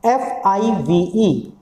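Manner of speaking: letters spelled very slowly; spelling out. Shown as a demonstration. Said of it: "अब ये phonetic symbol पूछता है".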